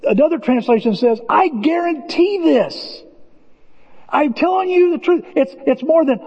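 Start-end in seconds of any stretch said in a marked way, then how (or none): 1.3-5.2 slow, high, weighty guarantee; emphatic promise. Acted. Pastor quoting Jesus' promise, slow and emphatic. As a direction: lean hard on every word.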